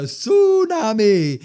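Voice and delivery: high, loud, drawn-out move name; hyped move call. Retold or imitated. Calls out the big wrestling move.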